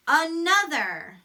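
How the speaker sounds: Another said slowly, each syllable clear; stretched sight word. Shown as a demonstration. Teacher modelling Another slowly for class. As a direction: draw out Another for children. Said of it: "practice our sight words".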